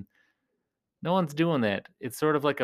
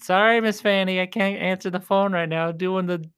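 louder apology called toward the ringing phone; calling out an apology; 5 semitones higher, 5 dB louder than usual. Real, not acted. Vlogger calling out sorry to Miss Fanny, loud. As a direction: call out sorry across the room.

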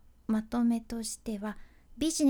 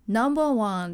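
imitated listening-test voice, louder; low male announcer; 5 dB louder than usual. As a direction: mimic a man's low English-test announcer. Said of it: "この声がね、めちゃんこ男性の低い声". (低い男性アナウンスの声真似)

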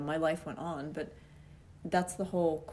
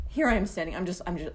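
ordinary delivery

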